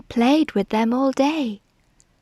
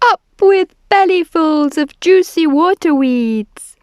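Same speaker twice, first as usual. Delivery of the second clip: loud, high chanting hoot; high hooted cheer; 7 semitones higher, 7 dB louder than usual. A narrator's reading. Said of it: "And they hooted".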